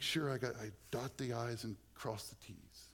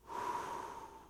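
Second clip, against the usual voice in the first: sigh. Real, not acted. Relief after resting on Christ.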